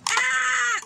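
very high squeal of delight; excited squeal. Real, not acted. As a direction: squeal as the gift is opened. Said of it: "I'm, like, getting all excited".

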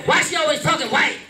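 put-on high, fast little-kid whine; brother's complaint. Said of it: "cat's out of the bag".

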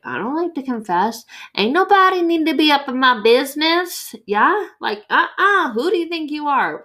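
high, loud sassy attitude; mock indignant diva; sassy defiant voice. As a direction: snap back like nobody's business is yours. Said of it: "we're like".

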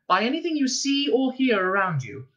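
high, composed welcome announcement; stewardess voice. A narrator's reading. Ship's voice welcoming rescued passengers, stewardess voice. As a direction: announce it like cabin crew.

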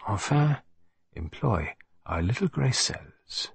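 Belgian detective in a huff, then hushed and deliberate; Poirot, fussy then calming. Acted.

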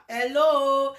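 ordinary delivery

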